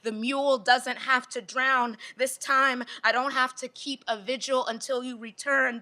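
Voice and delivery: rising, insistent spoken-word delivery; poem performance. Acted. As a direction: build each "This time" like a promise.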